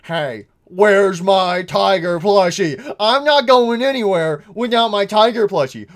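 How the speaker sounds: put-on stomp it out like a cranky toddler; grumpy little mimic. Gamer voicing grumpy Mimic wanting his tiger plushie, sulky loud demand. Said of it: "he's grumpy".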